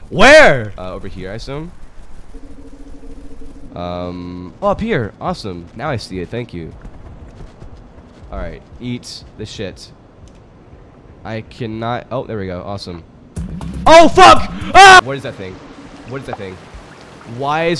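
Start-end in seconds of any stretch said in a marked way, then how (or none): none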